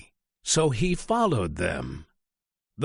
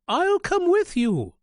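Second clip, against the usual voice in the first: high, louder goose voice, keen; eager goose; 3 dB louder than usual. Acted. Goose offers to come with the chick. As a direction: chime in brightly as the goose. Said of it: "said Goosey Lucy".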